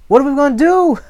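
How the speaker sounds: very high, loud wail; excited, panicked servant. Acted. Servant begging his master for a plan, panicked. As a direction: plead in hammed-up panic. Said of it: "Here I am getting all excited".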